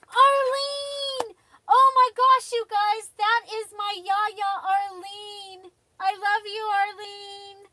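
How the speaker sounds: overjoyed high calling out to a loved one; excited. Real, not acted. Crafter greeting her Yaya joining the live, overjoyed.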